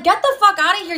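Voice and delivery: high-pitched indignant outburst, retold; retold angry outburst. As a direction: act out throwing someone out, pitch jumping up. Retold quarrel, throwing him out.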